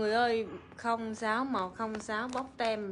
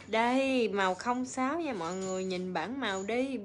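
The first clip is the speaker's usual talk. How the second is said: normal talking voice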